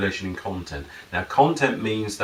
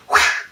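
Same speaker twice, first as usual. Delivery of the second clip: loud imitated whoosh; 8 dB louder than usual. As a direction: make a sudden rushing whoosh noise. Imitates picture shooting away.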